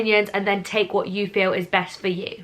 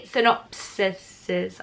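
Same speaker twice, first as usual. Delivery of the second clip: slow, halting attempt at a word; drawn-out, doubtful pronunciation; unsure; 3 semitones lower, 3 dB quieter, much slower than usual. Real, not acted. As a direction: feel your way through the syllables. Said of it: "I don't know what the word is".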